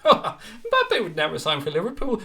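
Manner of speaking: slow, smug know-it-all voice taking off a commenter; imitating a smug viewer who thinks he's clever; mocking smart arse. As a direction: drag out "Mbappe would never sign for Liverpool" in a smug mocking voice. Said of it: "the smart arse that thinks he's original".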